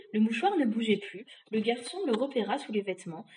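ordinary delivery

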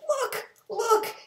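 high, loud panicked cry; the fish squeaks in alarm; frightened high squeal. Acted. Fish cries out to the boy in fear. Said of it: "our fish shook with fear".

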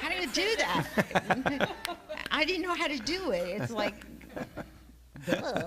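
high and loud, laughing through the words; half-laughing at her own confusion. Real, not acted. Speaker laughing about not knowing how to pray, high and loud.

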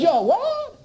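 a shocked boss asking again; half-mocked shocked boss; incredulous boss.

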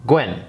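'Gwen' in a short, sharp fall; fourth tone. Shown as a demonstration. Teacher explaining the tone symbol, fourth tone. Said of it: "That symbol means fourth tone".

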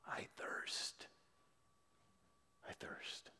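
breathless, unvoiced whisper fading away; fainting whisper. Jesus dying on the cross, a fading whisper. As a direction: let the words die on the breath. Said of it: "he cried with a fainting voice".

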